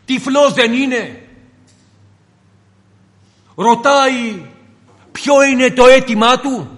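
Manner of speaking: preacher asking why a blind man needs to ask, rising at the end; rhetorical preaching. Acted. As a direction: argue it out like a puzzled preacher. Preacher argues rhetorically with crowd.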